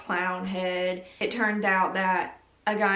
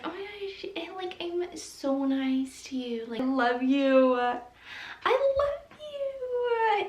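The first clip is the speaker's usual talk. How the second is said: higher, syrupy fake-nice voice; fake sweet; 9 semitones higher than usual. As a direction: gush falsely like a two-faced friend. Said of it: "they act like".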